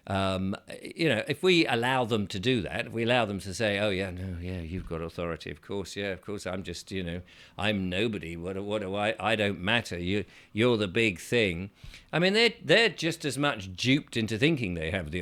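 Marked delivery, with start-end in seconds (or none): 3.8-11.7 meek submissive. Retold or imitated. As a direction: shrink yourself like a nobody. Mocking a meek submissive self.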